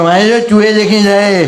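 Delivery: slow, distorted mock rage; loud slowed mock outburst; angry Aba Kadar voice. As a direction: ham up the furious old man. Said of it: "अबा का दर भड़क गया".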